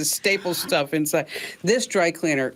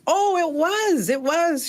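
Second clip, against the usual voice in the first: much higher, slow Vietnamese-accented imitation; 11 semitones higher, slower than usual. Dry cleaner admits the hundred dollars, in a high Vietnamese accent. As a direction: play the cleaner dodging, in her Vietnamese accent. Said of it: "She says".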